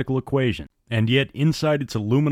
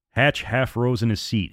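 ordinary delivery, nothing marked

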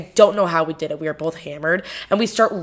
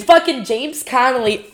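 usual speaking voice

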